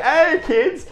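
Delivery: put-on big cheesy American kids-host hello; weird American accent. Entertainer greets the party kids in a hammed-up American accent. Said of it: "putting on this really weird American accent".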